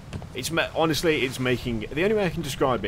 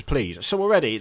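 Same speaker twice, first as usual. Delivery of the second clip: ordinary talk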